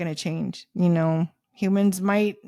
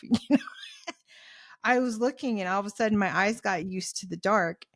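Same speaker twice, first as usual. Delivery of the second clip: ordinary delivery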